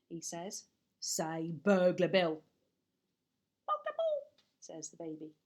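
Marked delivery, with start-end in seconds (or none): 1.0-2.4 slow, lower gruff coaxing; Burglar Bill voice. Acted. Burglar teaching the baby his name, slow and low. 3.7-4.3 put-on much higher, squeaky baby voice. Baby copying the burglar, high and squeaky.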